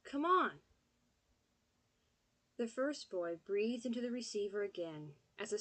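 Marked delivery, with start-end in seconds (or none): 0.0-0.6 loud, high impatient call. Acted. Boy hurrying Pop, loud high impatient call. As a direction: holler it.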